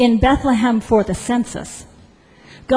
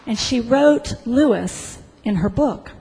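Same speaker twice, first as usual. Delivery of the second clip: ordinary talk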